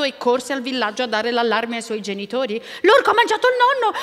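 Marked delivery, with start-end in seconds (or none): 2.8-4.0 high, loud, fast cry of alarm; child raising the alarm, high and hurried; alarmed announcement. A narrator's reading. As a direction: shout the terrible news to the village. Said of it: "annunciò loro".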